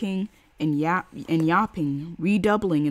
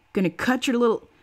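shrill threat; 6 semitones higher, 5 dB louder than usual. A narrator's reading. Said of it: "Luger Lady shrilled".

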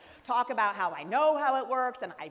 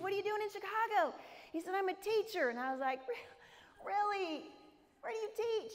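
forced politeness; 10 semitones higher, 4 dB quieter than usual. Retold or imitated. Her polite forced questions.

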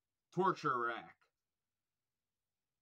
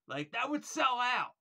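higher and louder, fired up about a sold-out show; excited; 8 semitones higher, 8 dB louder than usual. Real, not acted. Fan hyping a dream Florida tour lineup, loud and excited. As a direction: burst out with hype that it would sell out.

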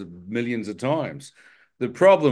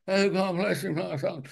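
lightly voiced pious vicar, higher and softer; a gentle hint of a churchy blessing; mock old vicar; 8 semitones higher, 4 dB quieter than usual. Preacher mocking an old vicar's soft blessing. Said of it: "it wasn't like the old vicar".

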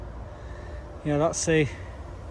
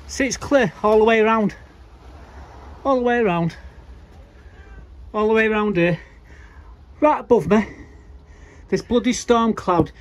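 indignant, raised voice, "all the way around"; exasperated disbelief; 6 semitones higher than usual. Real, not acted. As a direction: protest to the camera that it's only raining on you. Complaining cloud follows him around.

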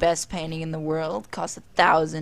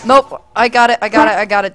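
loud high calls, much louder; shouting; 4 semitones higher, 10 dB louder, faster than usual. Real, not acted. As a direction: blurt it out in a rush. Grabbing something, calling out.

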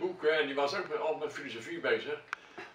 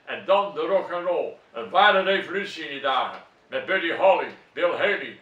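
emphatic, louder poetic declamation with measured phrasing; poetry recital; 3 semitones higher, 5 dB louder than usual. Acted. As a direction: declaim a poem with punched key words. Recites own poem. (gedragen, nadrukkelijke gedichtvoordracht)

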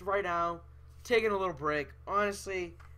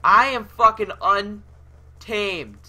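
slow boast; 3 semitones higher, 8 dB louder, much slower than usual. Real, not acted. Gamer boasting after kill.

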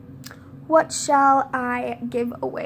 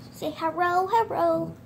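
usual speaking voice

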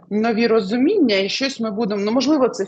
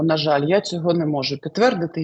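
normal talking voice

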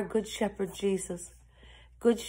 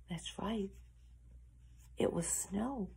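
near-whispered wonder; hushed reveal; 13 dB quieter than usual. A narrator's reading. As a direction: share the answer like a secret. Hushed answer to the riddle.

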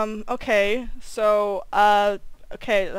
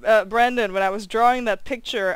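usual speaking voice

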